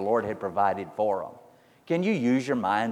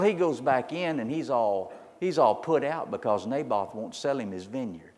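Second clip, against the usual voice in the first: ordinary delivery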